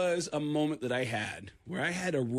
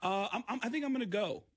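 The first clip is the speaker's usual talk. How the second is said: nervous hesitation; 5 semitones higher than usual. Retold or imitated. Nervously excusing himself from the trouble.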